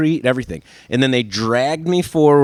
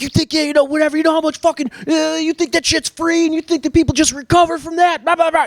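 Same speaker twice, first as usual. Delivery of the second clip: high, fast scolding voice; mock angry agent rant. Agent scolding the suspect about the damage caused. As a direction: rant at him like an outraged cop.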